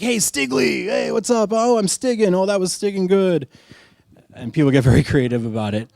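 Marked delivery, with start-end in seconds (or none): 0.0-3.5 excited greeting. Retold or imitated. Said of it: "it's like".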